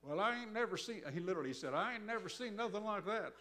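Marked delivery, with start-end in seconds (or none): 0.0-1.0 put-on Oklahoma accent. Prison guard amazed at the crowd, loud imitated Oklahoma accent. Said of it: "the Oklahoma accent". 1.7-3.3 put-on Oklahoma accent. Prison guard amazed at the crowd, loud imitated Oklahoma accent. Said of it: "the Oklahoma accent".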